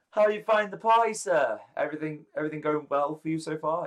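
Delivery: loud, high, merry greeting; David. Acted. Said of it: "and he's like".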